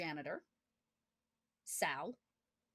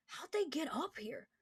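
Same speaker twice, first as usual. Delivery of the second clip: raised pitch, quiet wondering soldier voice; curious soldier, hushed; 7 semitones higher, 5 dB quieter than usual. Acted. Soldier staring down the shaft, hushed and higher. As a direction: peer over the edge and wonder aloud, hushed. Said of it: "he goes".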